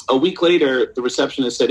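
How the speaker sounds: ordinary delivery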